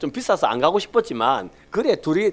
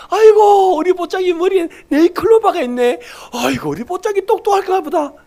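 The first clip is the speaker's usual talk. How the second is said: much higher, cooing voice with laughs; doting grandpa baby talk; 8 dB louder than usual. Retold or imitated. Grandfather cooing at the ultrasound of his unborn grandchild, high doting baby talk. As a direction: coo over the baby like a besotted grandfather. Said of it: "제가 꼭 미친 사람 같으면 그러고 있습니다".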